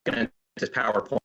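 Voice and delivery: choppy dropping call audio; words breaking apart on a bad connection; audio cutting out. Real, not acted. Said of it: "Audio is cutting out".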